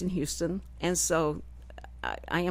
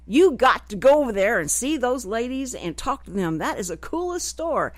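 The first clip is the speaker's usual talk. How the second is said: higher, louder imitation of someone insisting; urging; 4 semitones higher, 5 dB louder than usual. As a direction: push them eagerly to go see it. Acting out Dennis urging her over.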